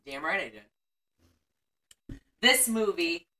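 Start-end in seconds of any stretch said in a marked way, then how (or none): 0.0-2.2 quiet. Real, not acted. Gleeful low aside.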